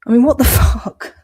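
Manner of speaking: incredulous laughing outburst; laughing disbelief. Real, not acted. Incredulous outburst mid rant.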